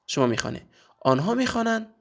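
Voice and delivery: ordinary talk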